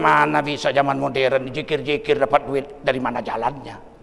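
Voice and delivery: low, dismissive aside as human reason; doubting inner voice. Retold or imitated. Preacher voices human reason doubting dhikr brings money, low sceptical voice. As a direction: drop well down low to voice the sceptic. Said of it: "Apa kata akal kita?".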